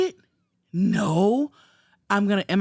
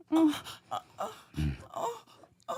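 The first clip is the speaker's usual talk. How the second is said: mock moaning; 9 semitones higher, 5 dB quieter than usual. Acting out sex noises in story.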